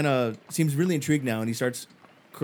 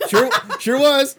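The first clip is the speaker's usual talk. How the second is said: loud, higher, bursting with laughter; laughing in character; 13 semitones higher, 11 dB louder, slower than usual. Acted. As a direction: crow it out while cracking up. Game master laughing as Fillmore.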